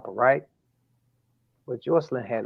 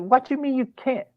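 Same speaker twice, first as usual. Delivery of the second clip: incredulous question; 12 semitones higher than usual. Retold or imitated. Father shocked by refusal.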